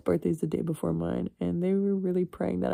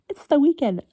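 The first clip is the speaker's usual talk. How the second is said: carefree excuse; 9 semitones higher, 8 dB louder than usual. Pretend.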